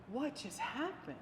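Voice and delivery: soft, slow, amazed murmur; barely voiced disbelief; hushed amazement. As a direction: daughter softly stunned again at her mother's answer. Daughter amazed again, hushed.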